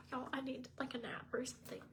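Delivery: sighing whisper, worn out after crying; drained, barely voiced; a weary sigh into a whisper; tired whisper. Real, not acted.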